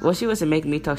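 usual speaking voice

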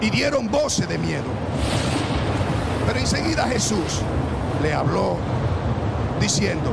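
loud, high, fervent Bible reading; preaching at full force. Acted. Preacher reads to the church, loud and high-pitched. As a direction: preach the storm story to the congregation.

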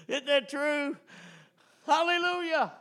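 high laughing hallelujah; joyful laugh. Real, not acted. Preacher to congregation, high joyful laughing praise.

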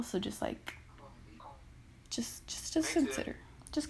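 dropping to a voiceless hush; trailing whisper. Real, not acted. Pleading with listeners, fading to a whisper. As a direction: fade down to a whisper.

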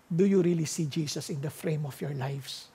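ordinary talk

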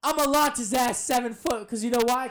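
high, loud wrestler's boast; acted trash talk. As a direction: shout it at the crowd before the slam. Acts out in-ring trash talk.

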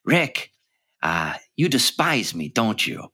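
lightly voiced sly underworld man; Peter Lorre voice. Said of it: "he says".

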